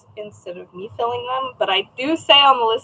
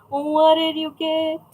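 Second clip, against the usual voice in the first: slow, higher, playful chant; drawn-out question; 4 semitones higher, slower than usual. Pretend. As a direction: stretch each word out on a raised pitch. Playfully repeats question to viewer.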